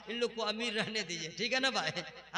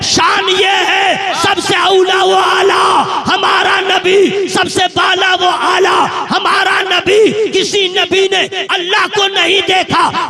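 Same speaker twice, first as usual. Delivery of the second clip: slow, much louder, ringing sermon voice; preaching at full force; 7 semitones higher, 21 dB louder, slower than usual. Acted. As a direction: thunder each line to the crowd. (पूरे जोश में तक़रीर)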